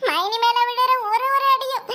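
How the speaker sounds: hammed-up weeping; theatrical crying. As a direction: sob it out like crocodile tears. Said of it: "நீ நிஜமா தான் அழுகுறியா?".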